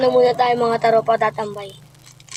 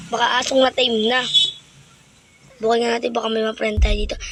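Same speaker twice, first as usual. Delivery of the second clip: ordinary talk, nothing marked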